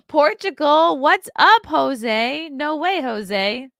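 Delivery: high, loud excited hello; thrilled to see a fan; excited greeting. Real, not acted.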